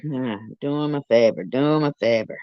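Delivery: ordinary talk, nothing marked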